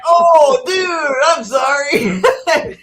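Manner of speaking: loud, high, panicked apology yelled from a car; apologetic shout. Retold or imitated. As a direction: shout sorry in a high, slow, sheepish panic. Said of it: "they're like".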